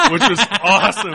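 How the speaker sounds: loud, fast, laughing through awesome. Real, not acted. Host laughing while retelling the cosplayer's joke.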